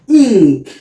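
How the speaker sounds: ink said slowly for learners; model word for class. Shown as a demonstration. Teacher modelling a word for students, clear and careful. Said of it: "here we have".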